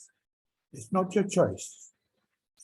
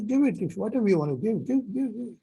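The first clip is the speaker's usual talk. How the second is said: higher, fast pushy give it; student urging the government, higher and fast; eager urging; 6 semitones higher than usual. Shown as a demonstration. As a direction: urge them to hand it over. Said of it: "you say".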